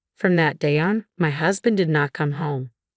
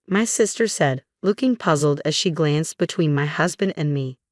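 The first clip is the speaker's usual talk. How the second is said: ordinary delivery, nothing marked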